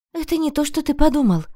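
high, quick flustered blurt. A narrator's reading. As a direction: blurt it out in a panic. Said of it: "выпалила я".

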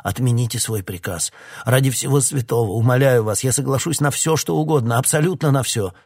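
desperate pleading. A narrator's reading. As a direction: beg for the dog's life. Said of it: "взмолился Хокинс".

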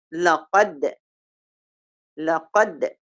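لقد said softly with the دال bouncing; qalqala demo. Shown as a demonstration. Teacher demonstrating the qalqala bounce on لقد. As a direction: echo the end of لقد, letting the دال bounce. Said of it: "ده في قلقلة".

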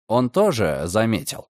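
ordinary delivery

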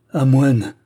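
last anguished outcry; despairing cry. A narrator's reading. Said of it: "pousse un dernier cri, un cri de douleur et de désespoir".

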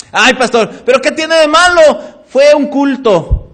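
high, loud complaining churchgoer voice; mock whiny church member. Church member asking pastor to pray for her kids, mock whiny. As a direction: ham up the excuse-making member. Member dismissing a missed service.

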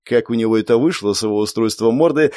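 usual speaking voice

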